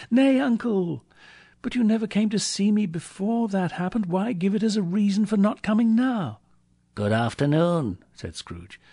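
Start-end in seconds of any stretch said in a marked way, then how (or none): 0.1-6.4 very high, insistent appeal; protesting nephew. A narrator's reading. Nephew objecting to his uncle's refusal, protesting. 7.0-8.0 firm, insistent farewell; curt dismissal. A narrator's reading. Scrooge dismissing his protesting nephew, curt.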